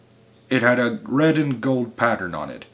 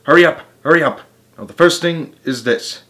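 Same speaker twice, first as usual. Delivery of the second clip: quick, raised urgent cry; rally the helpers in a higher voice; 6 semitones higher, 7 dB louder than usual. A narrator's reading. Mugglewump orders his helpers to start, crying out. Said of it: "cried Mugglewump".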